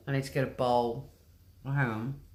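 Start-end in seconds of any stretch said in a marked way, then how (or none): none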